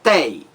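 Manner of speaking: TEI alone and firm; one isolated, emphatic "Tei"; stressed syllable shown. Shown as a demonstration. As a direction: punch "Tei" as the strong syllable. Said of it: "A mais forte".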